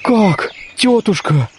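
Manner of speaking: startled, slow, high exclamation; alarmed outburst at the word marriage; frightened cry. Acted. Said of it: "вскричал испугавшись".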